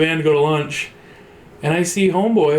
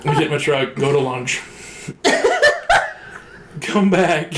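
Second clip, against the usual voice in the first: ordinary talk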